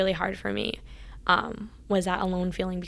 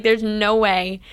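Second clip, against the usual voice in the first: imitating mom's loud flat refusal; louder, mock-stern no; retold firm refusal; 8 dB louder, slower than usual. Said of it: "She's super against it".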